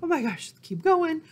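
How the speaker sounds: ordinary talk, nothing marked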